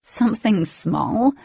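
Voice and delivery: disbelieving, voice rising in shock. A narrator's reading. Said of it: "Shock heightened my tone".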